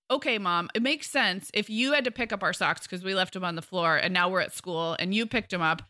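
ordinary talk, nothing marked

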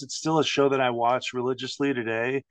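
ordinary delivery

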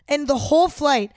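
normal talking voice

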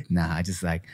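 low smooth flirting radio voice; deep flirty DJ. Retold or imitated. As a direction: croon it like a late-night DJ. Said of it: "he said something about".

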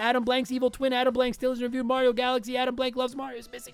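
rushing the names, breath running out; all in one breath. Real, not acted. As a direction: race through the list on one breath until it fades. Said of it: "try to get through the rest of these in one breath".

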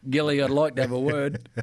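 a touch of a stern coach. Retold or imitated. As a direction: coach calling a player in for a talking-to. Stern coach after newsflash.

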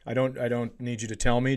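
ordinary delivery

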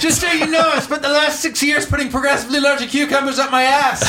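high, loud laughing squeal; hammed-up doomsday wail; panicked skit voice. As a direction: shriek the doom through giggles. Mock final confession skit.

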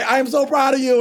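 high, gushing parental praise, a touch hammed-up. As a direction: overflow with pride at the child. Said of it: "I fight the urge to say".

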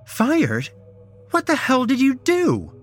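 very high, loud questioning; outraged demand. A narrator's reading. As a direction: shriek the question in disbelief. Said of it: "she demanded".

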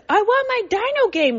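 put-on high, bossy toddler demand; bossy child. Mother mimics her son's bossy demand, high and insistent. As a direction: play the little boss demanding his game. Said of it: "he says it all, like, bossy".

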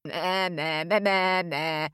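mock bleating; rude ram bleat. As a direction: bleat rudely like a sheep. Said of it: "went the rude Ram".

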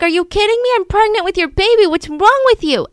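high, loud acted disbelief; indignant mock outburst; incredulous outrage. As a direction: play the bewildered wife calling him out, pitch way up. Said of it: "Shirley's confused".

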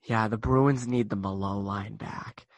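put-on low Boston radio caller accent. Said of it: "you have to do the accent".

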